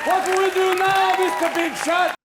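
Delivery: high, loud, taunting demand; sarcastic demand. Acted. Stranded Hebrews challenging Moses at the sea, sarcastic shout. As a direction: yell it as an accusing challenge.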